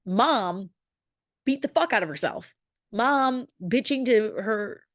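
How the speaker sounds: ordinary talk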